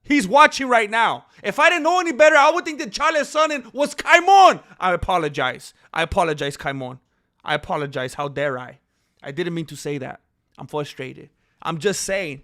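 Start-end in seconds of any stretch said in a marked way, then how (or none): 4.8-12.4 grovel, clearly not meaning it; hammed-up sorry, voice dropped low; mock contrite apology. Mock apology to suspected spy.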